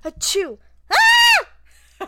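sneeze, then a piercing high shriek; sneeze then scream. Retold or imitated. Mimicking a classmate who screams after sneezing. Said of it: "So she'd go".

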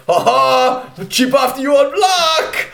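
high laughing taunt; dad laughing. Retold or imitated. Dad laughing at son from next room, mock laughing taunt. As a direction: imitate dad laughing from bed. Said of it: "I could hear him laughing".

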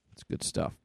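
ordinary talk, nothing marked

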